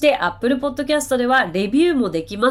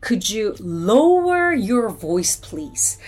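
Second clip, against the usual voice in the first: slow, careful English model reading; very slow, clear teacher's demo of the phrase; slow model sentence; much slower than usual. Shown as a demonstration. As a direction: say it slowly for learners to copy. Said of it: "だったら".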